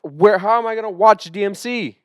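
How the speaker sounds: high, loud disbelieving question; incredulous outburst. Real, not acted. Podcaster challenging co-host's filming idea, loud incredulous protest. Teased about filming by shelf.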